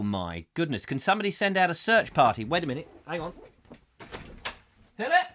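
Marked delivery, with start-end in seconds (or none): 5.0-5.3 high mock calling for lost friends. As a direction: call out as if searching a field. Calling out for missing viewers.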